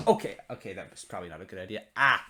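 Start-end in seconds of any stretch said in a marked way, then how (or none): none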